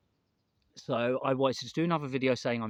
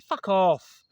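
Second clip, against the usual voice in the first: loud retold snap, louder and higher; snapping back; 5 semitones higher, 10 dB louder than usual. To a tutting passer-by, a loud retold snap. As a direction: bite back at someone who tutted. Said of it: "I said".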